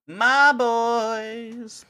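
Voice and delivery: high, stretched hype-man call; drawn-out high mock greeting; hyped streamer greeting.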